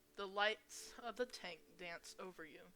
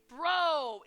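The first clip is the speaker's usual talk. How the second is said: excited; 8 semitones higher, 13 dB louder than usual. Acted. Excited relief at finding friend.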